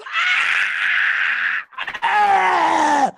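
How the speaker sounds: high loud wailing screams; mock screams. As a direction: scream into the dark streets. Imitating screams in the streets.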